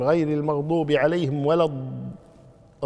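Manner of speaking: slow recitation ending on a held الض-; doubled dad. Shown as a demonstration. Teacher pointing out a shadda, slow, stopping on held الض-. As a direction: draw the line out and sit on the heavy double dad of الض-. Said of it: "مشددة".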